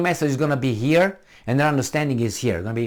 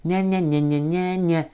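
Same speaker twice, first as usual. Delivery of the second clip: higher-pitched nonsense yammer; mocking gibberish nya-nya; 5 semitones higher than usual. What they hear when you explain, high mocking gibberish. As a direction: babble it as meaningless noise. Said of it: "For them is".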